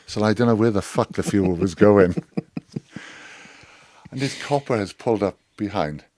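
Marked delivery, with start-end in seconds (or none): 2.2-3.5 short soundless laugh; a quick laugh at his own tale. Real, not acted. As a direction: chuckle after the punchline.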